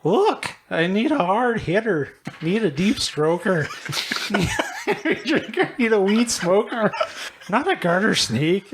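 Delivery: high, hammed-up cartoon voice; silly high-pitched movie-character impression; cartoon side character. Reading rap lyrics as the side character, hammed-up. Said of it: "He does voices in car".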